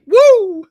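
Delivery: high, loud whoop; excited whoop. Real, not acted. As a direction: let out a high party whoop. Whoops after joking about tonight.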